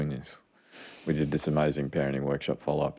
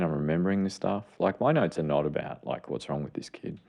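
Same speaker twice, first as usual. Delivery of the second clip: usual speaking voice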